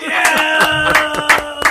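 loud, high, long-held yeah; hyped cheer. Real, not acted. Co-host hyping up the group, loud drawn-out cheer. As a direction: cheer it out at full volume.

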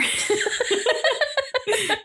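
long, high laughing fit; high laugh burst. Real, not acted. Cracking up at the name of a creature, high giggle.

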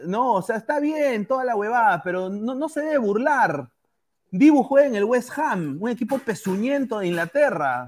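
ordinary talk, nothing marked

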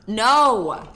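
sudden loud no; shout. Real, not acted. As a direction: yell it out. Friend smacking the mic.

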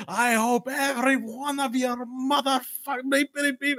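acted distant raging yell; yelling up from below. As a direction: yell curses up from a pit, high-pitched. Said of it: "he's yelling up, and he's like".

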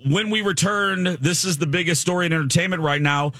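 upbeat on-air host intro; bright teaser before the break; radio host voice. Acted.

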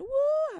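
sudden high, loud mock church whoop; mock praise whoop. Spirit mocking worshippers with a high loud whoop.

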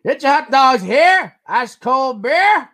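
high, slow, drawn-out vendor call; stadium vendor hawking. Pretend. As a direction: hawk hot dogs and beer like a ballpark vendor. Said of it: "Waiting for the hot dog man to come by".

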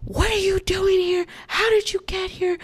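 high-pitched mock interrogation; retold police yell, held back in volume; aggressive yelling, imitated. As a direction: demand it like an aggressive cop. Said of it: "yelling at us aggressively".

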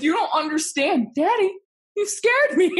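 put-on high, scared little girl voice; squeaky frightened child whine. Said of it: "I'm like".

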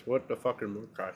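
talking while chewing; words through a mouthful of food; mouth full. Real, not acted. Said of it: "Sorry, I'm eating".

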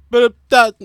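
loud, high mimicked word sounds; imitating lyric sounds. Shown as a demonstration. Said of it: "I just try to sound like how they sound".

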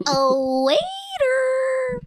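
high squeaky cartoon call; SpongeBob voice. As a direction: squeal for the waiter. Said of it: "when I hear SpongeBob, I think".

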